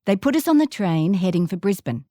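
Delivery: usual speaking voice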